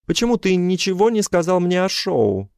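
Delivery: high indignant woman's voice; ex-wife voice. Acted. Ex-wife's texts voiced.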